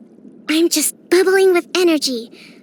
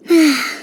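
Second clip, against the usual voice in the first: brief falling sigh. Acted. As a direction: let out a short sigh on "a". Content sigh over reading alone.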